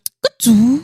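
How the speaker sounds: high laugh of disbelief; disbelieving laugh. Real, not acted. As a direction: laugh it off in shock. Laughing at printer turning on.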